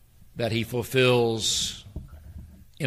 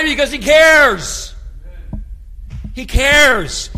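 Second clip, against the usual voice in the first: much higher, much louder proclamation; shouted climax; 13 semitones higher, 12 dB louder, slower than usual. Real, not acted. Preacher at the peak of the sermon: full shout. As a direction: cry it out to the back row.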